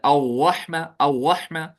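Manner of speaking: अव्वहमा with the र turned into a व sound. Retold or imitated. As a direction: imitate the wrong अव्वहमा, tongue not touching the palate. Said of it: "बाज लोग कहते हैं, वो पढ़ते हैं". (अव्वहमा, र की जगह व की आवाज़)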